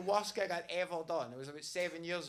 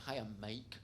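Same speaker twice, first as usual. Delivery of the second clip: lower, gentle, overly friendly greeting; soft chirpy hello; 8 semitones lower, 8 dB quieter than usual. Retold or imitated. Comic retelling how he greeted a navy man, soft and sweet. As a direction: coax him like a nervous host. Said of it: "And I went".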